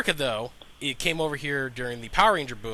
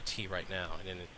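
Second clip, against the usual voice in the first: sultry voice; 4 semitones lower, 8 dB quieter than usual. Shown as a demonstration. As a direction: drop into a sultry purr. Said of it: "our sultry voices".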